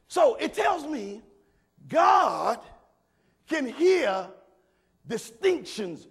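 slow, loud preached words; preaching at full force. Acted. Pastor driving home that God hears distinctions, slow and loud. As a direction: stretch and push each word.

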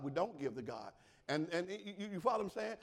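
ordinary delivery

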